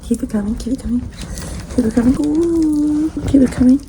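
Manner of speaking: building from eager to high, loud cheering; hyping up the model. Pretend. Photographer urging the model through a shoot, mounting excitement.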